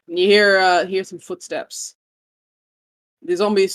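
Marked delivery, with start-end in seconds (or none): none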